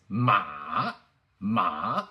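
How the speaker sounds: MA dipping down, then running back up; long dip-and-rise MA; third tone. Shown as a demonstration. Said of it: "the third one is".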